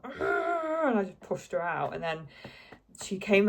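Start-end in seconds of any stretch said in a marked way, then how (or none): 0.0-0.9 a strained, high cry of effort from the final push; mock pushing cry. Mum retelling the birth, a mock pushing cry. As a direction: let out the push as you remember it, half-acted. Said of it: "and I was like".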